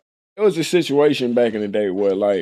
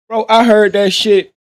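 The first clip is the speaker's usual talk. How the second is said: loud, slow and heated; louder, heated retelling; angry outburst; 6 semitones higher, 6 dB louder than usual. Retold or imitated. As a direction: let the anger boil up at the memory. Retelling getting mad.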